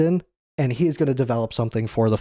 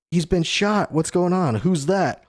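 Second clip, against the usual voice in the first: higher-pitched, retold alarm; mock freak-out; 6 semitones higher than usual. Agents freaking out after Shaka is shot, mildly acted alarm. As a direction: imitate startled agents, raised pitch without shouting. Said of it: "The Cypher Pole agents freak out".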